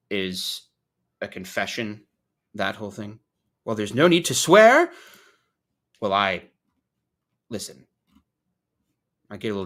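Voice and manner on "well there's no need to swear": louder, higher mock reproach; mock scolding. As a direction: playfully take offence at the language. Acted reply to own swearing.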